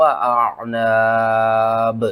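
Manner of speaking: slow drawn-out tajweed chant; Quran recitation. Shown as a demonstration. Teacher demonstrates reading alif with jazm, slow chant. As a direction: chant slowly, with a sharp jolt on the alif. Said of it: "किस तरह?".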